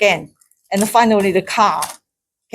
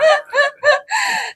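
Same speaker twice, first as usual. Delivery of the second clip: delighted high laugh. Real, not acted. Amazed the door opened, laughing high. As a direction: laugh with relief.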